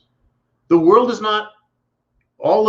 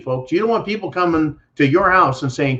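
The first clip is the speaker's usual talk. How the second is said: normal talking voice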